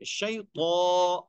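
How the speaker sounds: शैतो very slow with a heavy तो; heavy to sound. Shown as a demonstration. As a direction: stretch शैतो out. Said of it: "ता नहीं पढ़ेंगे". (मोटा शैतो)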